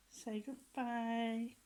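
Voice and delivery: put-on slow, drawn-out goodbye to the children; puppet goodbye. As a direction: stretch it out slowly for the little ones.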